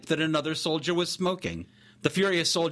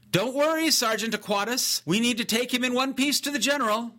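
high, raised reply across a distance; calling back; 9 semitones higher than usual. A narrator's reading. Young soldier answering his sergeant across the clearing, calling back high. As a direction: pitch it well up to reassure the sergeant.

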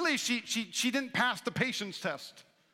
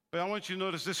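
usual speaking voice

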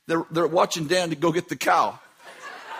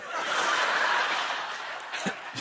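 silent wheezing laugh; an airy, voiceless laugh; breathless laugh; 8 dB quieter than usual. Real, not acted. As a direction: laugh soundlessly at the memory. Laughing at his own story.

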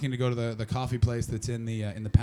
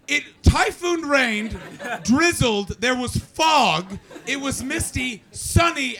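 exasperated rant; 10 semitones higher, 4 dB louder than usual. Real, not acted. Ranting about crazy weather.

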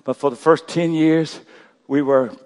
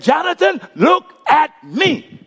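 firm call; 13 semitones higher, 4 dB louder, slower than usual. Retold or imitated. Father calling to scared son.

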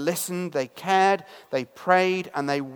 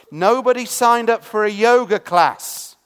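loud; 4 semitones higher, 7 dB louder than usual. Acted. Preacher's emphatic punchline.

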